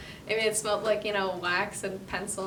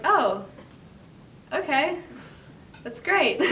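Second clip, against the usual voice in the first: higher, louder mock enthusiasm breaking into a laugh; acted awkward delight, pitched up; bemused reaction; 6 semitones higher, 4 dB louder, slower than usual. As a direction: play up a taken-aback, polite approval. Acting out her bemused reaction.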